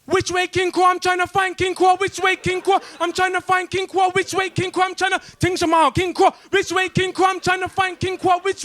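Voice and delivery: high, repetitive mock Chinese tourist accent. Chinese tourists asking the way, in a mocking accent. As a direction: play a lost Chinese tourist with a broad put-on accent. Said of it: "they go exactly like this".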